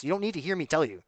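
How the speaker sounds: normal talking voice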